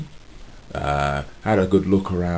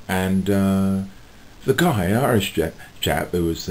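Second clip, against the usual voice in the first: ordinary talk, nothing marked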